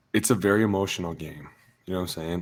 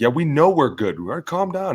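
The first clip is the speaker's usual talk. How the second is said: exasperated retort; 7 semitones higher, 4 dB louder than usual. Acted. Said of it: "trying to convince themselves".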